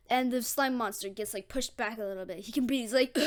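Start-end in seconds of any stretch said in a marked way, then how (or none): none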